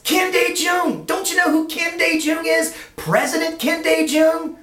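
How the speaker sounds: very high, emphatic disbelief; incredulous boss. Retold or imitated. Boss telling Lance who the man is, high incredulous exclaiming. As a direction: hammer out the name, aghast. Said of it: "And the boss says".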